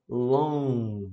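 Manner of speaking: 'Long' held on the N, no G; silent G. Shown as a demonstration. Teacher showing where to stop, silent G. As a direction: end 'Long' on a nasal N without releasing G. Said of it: "a gente não pronuncia esse".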